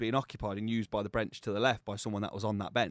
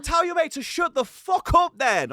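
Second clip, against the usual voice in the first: higher, louder, hammed-up rage; retold angry outburst; 9 dB louder than usual. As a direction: relive the gym row with mock fury. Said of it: "I was going ballistic".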